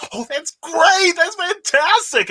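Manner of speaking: high, slow, delighted wife voice; excited wife. Retold or imitated. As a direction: gush like a proud wife. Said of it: "She goes".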